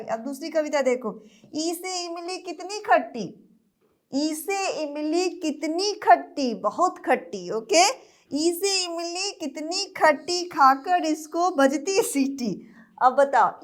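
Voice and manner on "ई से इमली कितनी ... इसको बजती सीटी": sing-song nursery rhyme; poem recital. Acted. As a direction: recite the rhyme for small kids. (बच्चों के लिए लय में कविता)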